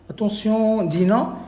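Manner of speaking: loud, sharp call for attention. Real, not acted. Teacher calling a student to attention, loudly. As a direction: snap out the student's name to get her attention.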